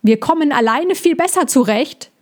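defiant half-call; the hedgehog hiding his hurt, nearly shouting; almost calling. Acted. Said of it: "Den letzten Satz rief er fast".

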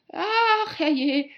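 high, loud cry of dismay; worried cry. A narrator's reading. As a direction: exclaim as you notice your blunder, full of worry. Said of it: "rief Alice voller Sorge". (besorgter, lauter Ausruf)

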